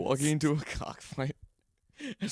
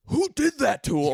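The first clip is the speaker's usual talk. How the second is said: high, loud outraged demand; mock-furious shouted question; imitated angry yell; 11 semitones higher, 5 dB louder than usual. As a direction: yell it like an irate friend on the phone. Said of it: "Fry's yelling at me on the phone".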